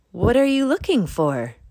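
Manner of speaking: a curious skunk peeking out. A narrator's reading. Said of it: "she asked".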